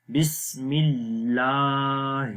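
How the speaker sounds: slow, stretching the aa in اللہ; long laam. Shown as a demonstration. Teacher showing the long vowel. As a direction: draw out اللہ gently. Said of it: "اس کو لمبا کریں گے".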